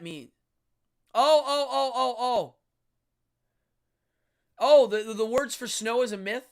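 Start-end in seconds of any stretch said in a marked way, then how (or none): 1.1-6.5 loud, high burst of oh, oh, oh; real surprise. Real, not acted. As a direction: caught off guard by a correction. Surprised reading a viewer correction.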